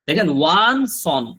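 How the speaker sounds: drawn-out, loud demo of one সন; teacher modelling one সন slowly; slow drilled model. Shown as a demonstration. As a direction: stretch one সন slowly and clearly. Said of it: "একটু উচ্চারণ করবেন".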